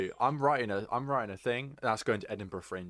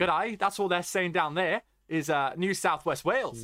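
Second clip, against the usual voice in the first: put-on higher, louder shoddy Australian accent; bad Australian accent; 7 semitones higher, 4 dB louder than usual. Mocking Australians, shoddy Australian accent. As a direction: attempt an Australian accent and miss. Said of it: "I can't do an Australian accent very well".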